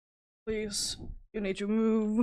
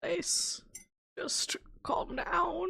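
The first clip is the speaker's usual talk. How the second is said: high, slow whisper; breathy high whisper begging the cat to settle; whispered plea; 11 semitones higher, 5 dB quieter, much slower than usual. Real, not acted. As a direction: beg the cat softly in a whisper. Pleading with the cat.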